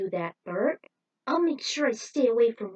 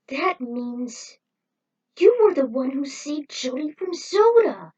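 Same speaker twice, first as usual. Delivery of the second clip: higher and louder, building to an exclamation; astonished realization; 7 semitones higher, 8 dB louder, slower than usual. Acted. Rick realizing Bert saved Jody, astonished. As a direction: it all clicks at once.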